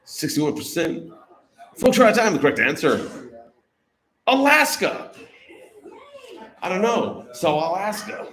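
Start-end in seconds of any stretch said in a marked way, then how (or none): 1.8-5.1 loud game-show reveal; host announcement. Real, not acted. Host tells players time is up, loud announcement. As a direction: call it out big to the whole room.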